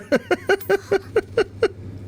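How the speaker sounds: high, rhythmic cackle; high-pitched stuttering laugh; laughter. Real, not acted. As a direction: cackle after a joking threat. Laughs after a mock threat.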